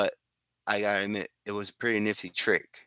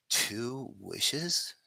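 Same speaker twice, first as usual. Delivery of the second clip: softer, questioning echo with a rising end; disappointed query; 3 semitones higher, 7 dB quieter than usual. Acted. Wisher hears he gets only two, let-down questioning. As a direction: repeat it back softly as a doubtful question.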